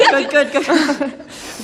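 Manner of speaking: slow words through laughter; breathless laughing. Real, not acted. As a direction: laugh out each good. Laughing reply.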